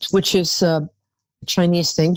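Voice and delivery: ordinary delivery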